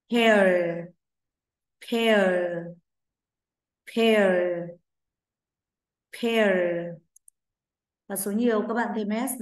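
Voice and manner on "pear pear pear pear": model word. Shown as a demonstration. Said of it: "And what is it?".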